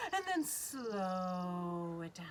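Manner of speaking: low, drawn-out slow motion; slowing right down. Pretend. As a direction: stretch SLOW out as it sinks. Voice mimics slowing wings.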